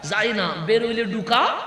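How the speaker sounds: soar up and hammer it to the crowd; preaching at full force. Acted. Preacher rousing the congregation about the Prophet, full-force preaching. Preacher climaxes on the enlarged house.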